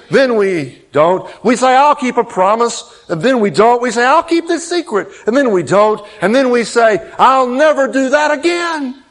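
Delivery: high, loud preaching climax; full-force preaching. Acted. Pastor preaching to his congregation, full force. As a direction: preach it out to the back row.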